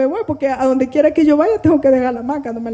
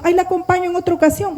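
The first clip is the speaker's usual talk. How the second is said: quick, higher mock brush-off; imitated polite excuse; 6 semitones higher, faster than usual. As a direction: mimic someone politely wriggling out, fast and high. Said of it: "me dice alguno".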